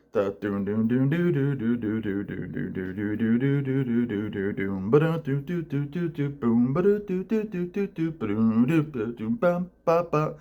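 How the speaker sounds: imitating the guitar part with the voice; hummed guitar riff. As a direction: hum the guitar line, slowing at the end. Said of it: "Just like that. That's exactly how it sounds".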